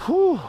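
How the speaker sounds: loud high whew; high exhale. Real, not acted. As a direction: blow out hard after effort. Exhale after set.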